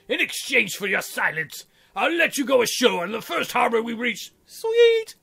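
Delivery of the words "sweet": high, loud squeak of delight; squeaky gleeful aside. Acted. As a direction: squeal it with glee.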